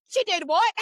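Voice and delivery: high, disbelieving mock shock; shocked grandma. Grandmother hears the news on the phone, high mock shock. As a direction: sound scandalized at the news.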